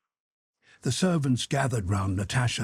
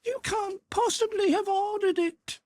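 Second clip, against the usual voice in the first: put-on high indignant girl. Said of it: "she screamed".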